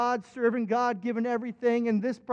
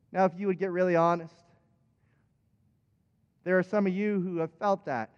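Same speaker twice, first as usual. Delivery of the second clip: ordinary talk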